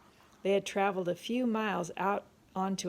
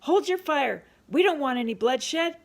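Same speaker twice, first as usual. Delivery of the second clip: loud, raised-pitch command; urgent shouted order; 8 semitones higher, 9 dB louder than usual. A narrator's reading. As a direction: call out firmly to stop the shooting. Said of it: "Livingston kept saying".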